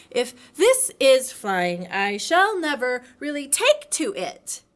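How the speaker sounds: bounced about while speaking; a hint of jerky jostled Piglet; jolted Piglet. A narrator's reading.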